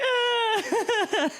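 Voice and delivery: high squeal of laughter through tears; real laugh-cry burst. Real, not acted. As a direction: let out a teary laughing cry. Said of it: "just bursting into tears".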